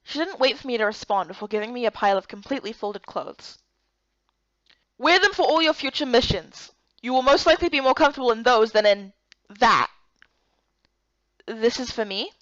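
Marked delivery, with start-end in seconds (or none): 5.0-9.9 put-on louder, commanding older woman; firm orders, no room to argue; stern Miko. Miko orders new clothes.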